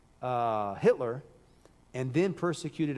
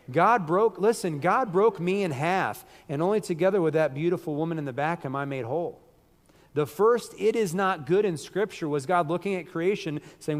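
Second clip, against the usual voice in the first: loud, raised-pitch sermon delivery; emphatic pulpit proclamation; impassioned preaching; 5 semitones higher, 7 dB louder than usual. Real, not acted. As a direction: preach it out, high and forceful.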